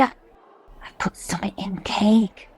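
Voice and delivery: slow, hushed conspiratorial whisper; a slow, plotting whisper across the visiting table; secretive whisper. Acted. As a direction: breathe it so the guards can't hear. Smuggling secret to prisoner husband.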